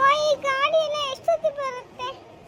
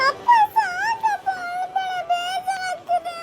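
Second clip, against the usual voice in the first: slow, louder, sobbing plea to stop; crying plea; 3 semitones higher, 3 dB louder, slower than usual. Acted. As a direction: beg him to stop crying while crying yourself. Daughter begs crying father stop.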